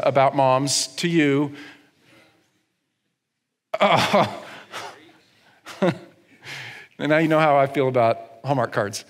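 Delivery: ordinary talk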